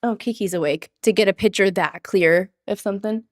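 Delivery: ordinary delivery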